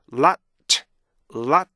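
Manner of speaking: LOT punched, a pause, then a separate T; "lot" in two detached beats. Shown as a demonstration. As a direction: split "lot" into vowel stop and released T. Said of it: "두 개의 리듬이에요".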